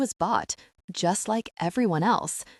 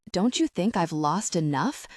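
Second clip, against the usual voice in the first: usual speaking voice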